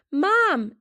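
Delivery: yelled. A narrator's reading. Girl greeting her mom, a happy yell. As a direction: shout it across to mom with joy. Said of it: "Isabel yelled".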